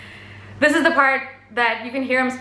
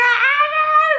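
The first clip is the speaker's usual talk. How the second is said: very high fangirl squeal; squealing in anticipation; excited squeal; 3 dB louder than usual. Real, not acted. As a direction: let out a high, thrilled "ah". Squeals before the best part.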